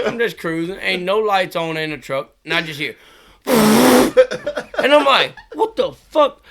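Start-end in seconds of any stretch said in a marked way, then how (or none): 3.2-6.4 high, loud, drawn-out laughing outcry; laughing disbelief. Real, not acted. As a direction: cackle out the shock. Laughing shock at the spray.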